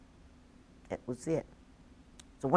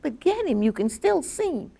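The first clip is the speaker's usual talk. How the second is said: urgent plea; 10 semitones higher, 6 dB louder than usual. Retold or imitated. Urging the officer to chase.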